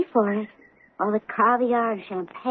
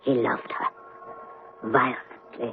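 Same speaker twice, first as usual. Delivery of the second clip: hushed whisper; 13 semitones lower, 8 dB quieter, slower than usual. Acted. Whispered confession about lost love.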